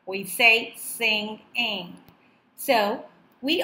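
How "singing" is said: "singing" slowly split in two; syllable by syllable. Shown as a demonstration. Teacher showing students two syllables, "singing" split. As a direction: say "singing" as two separate beats, sing, ing. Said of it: "dividing the word into two syllables".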